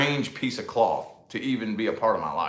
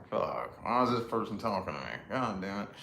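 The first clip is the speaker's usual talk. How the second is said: muttered inner thought; 2 semitones lower, 8 dB quieter than usual. Retold or imitated. Annoyed vlogger muttering about strangers making small talk. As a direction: mutter it to yourself, irritated.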